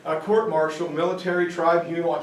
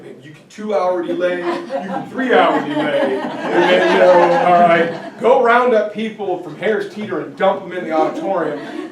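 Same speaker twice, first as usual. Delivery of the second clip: laughing louder as the list gets sillier; loud laughter breaking into the words; 6 dB louder than usual. Retold or imitated. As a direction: chuckle through the mock plan, building. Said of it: "I'm like".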